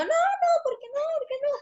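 put-on high, pleading child's whine; mock-whiny child begging; whiny kid protest. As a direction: whine like a kid who lost the TV. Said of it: "y yo".

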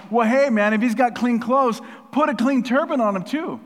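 loud, high burst of eagerness; excited blurting. Acted. Zechariah butting in about Joshua's clothes, blurting excitedly. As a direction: blurt it out as an acted, eager interjection. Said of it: "he just blurts out".

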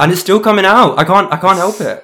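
high, loud Irish accent creeping out; slipping Irish accent. Real, not acted. Confessing to a friend that the accent keeps escaping, slipping Irish accent. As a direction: let an Irish lilt slip out, pitched high and loud. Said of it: "terrible accent comes out".

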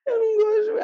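very high, garbled mumble; mumbled confession. Retold or imitated. Repeating the secret to a puzzled mother, mumbled. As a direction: blur the words into a high squeak. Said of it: "Fala, mas gesticula".

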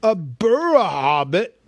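loud, puzzled troll question; startled trolls. A narrator's reading. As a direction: trolls caught off guard. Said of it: "a bit startled".

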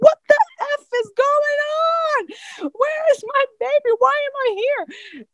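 very high, frantic squeal; retold panic. Mother demands her baby while being stitched, high frantic panic. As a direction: re-enact hysterical confusion at moderate volume.

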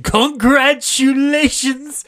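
high, loud, drawn-out cry of congratulations; gushing congratulations. Retold or imitated. The director bursts in gushing congratulations. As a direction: burst in overjoyed with the news. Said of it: "Roger Debris comes in".

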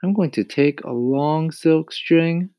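put-on boy voice. A narrator's reading. James explains plan.

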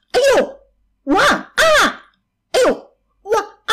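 high-pitched yelps of pain; pained shrieks. A narrator's reading. Stung monster inside the cave, high shrieking yelps. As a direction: yelp at each sting, ending in a long cry. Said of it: "怪兽尖叫着".

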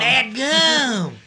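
very high and very loud yelp of "Dadgum!"; Tow Mater. Retold or imitated. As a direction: echo it like a goofy cartoon tow truck. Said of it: "my old friend Tow Mater".

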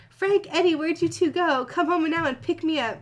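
put-on squeaky demanding girl voice; whiny young woman calling home; high-pitched Columbia. Columbia on phone, much higher.

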